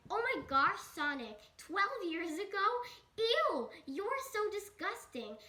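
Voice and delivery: high, loud mock outrage; hammed-up shocked scolding, pitch leaping up; girlfriend's appalled disgust. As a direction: scold him as if utterly grossed out.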